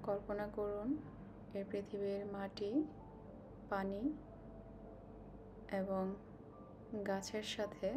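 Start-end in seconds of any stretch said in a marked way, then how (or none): none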